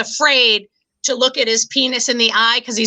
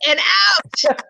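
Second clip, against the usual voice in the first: mock shout; 6 semitones higher than usual. End of scrubbing mime, shouted.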